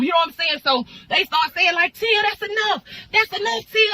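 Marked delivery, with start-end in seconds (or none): 1.9-3.9 high, pleading; pleading to stop. Retold or imitated. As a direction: beg her to stop fighting. Bystanders pulling her off.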